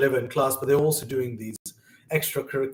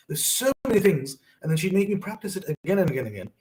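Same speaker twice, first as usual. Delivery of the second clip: ordinary talk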